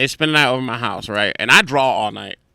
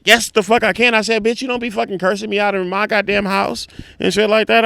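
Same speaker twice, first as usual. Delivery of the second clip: higher, heated retort; retold angry comeback; 8 semitones higher than usual. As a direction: argue back, reliving the quarrel. Acts own angry comeback.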